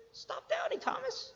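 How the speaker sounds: very high, softened command; high, soft voice. Acted. Preacher repeats Jesus's line to Thomas, high and soft. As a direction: float it up high and light.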